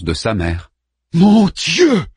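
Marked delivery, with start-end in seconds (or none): 1.1-2.1 high, loud cry of awe; a sudden loud gasp of awe; awed cry. A narrator's reading. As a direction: cry out, overwhelmed by wonder. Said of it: "s'écria Harman".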